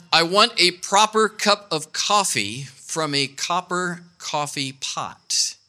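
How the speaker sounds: slow, careful tongue twister. Shown as a demonstration. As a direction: drag out each word of "proper cup of coffee". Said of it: "I can't even say it slow".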